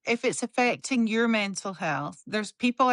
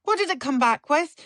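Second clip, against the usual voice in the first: ordinary delivery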